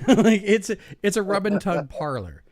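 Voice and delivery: laughing loud through the words. Real, not acted. Embarrassed storyteller cracking up while naming the place. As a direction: laughs as he names the parlor.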